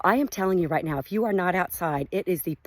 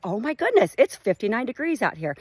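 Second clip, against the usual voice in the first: higher, louder delighted oh my goodness; amazed exclaim; 5 semitones higher, 4 dB louder than usual. Retold or imitated. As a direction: gush about the perfect weather. Stepping outside, amazed at weather.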